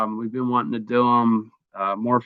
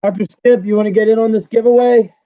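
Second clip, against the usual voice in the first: enthusiastic call out; 10 semitones higher, 6 dB louder than usual. Real, not acted. Calling out to chat member.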